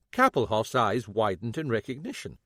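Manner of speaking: normal talking voice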